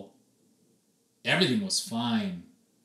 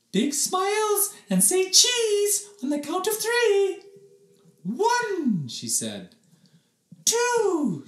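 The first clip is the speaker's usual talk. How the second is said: singsong teacher voice; 5 dB louder, slower than usual. Acted.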